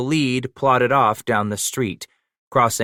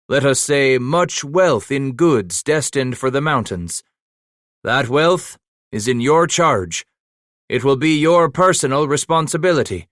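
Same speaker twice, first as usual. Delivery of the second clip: raised, higher voice of a leader giving orders; commanding leader; 6 semitones higher, 4 dB louder than usual. A narrator's reading. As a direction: announce it to the whole caravan, firm and proud. Campbell addresses the men.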